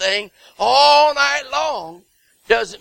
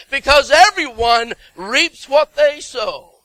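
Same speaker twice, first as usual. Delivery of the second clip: loud, ringing sermon delivery; full-force preaching; 5 dB louder than usual. Acted. Pastor urging the congregation, loud and fervent. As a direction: preach it out with conviction to the pews.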